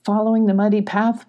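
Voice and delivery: ordinary delivery, nothing marked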